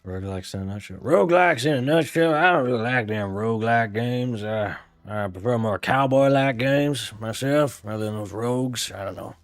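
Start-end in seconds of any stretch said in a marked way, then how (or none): 1.0-2.3 cowboy voice. Acted. Gamer redoing the title as a cowboy, drawling. 2.3-9.3 folksy cowboy. Acted. Cowboy explaining he prefers cowboy-like games, drawling.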